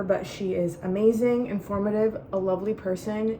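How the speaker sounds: ordinary delivery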